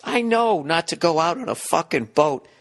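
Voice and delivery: ordinary delivery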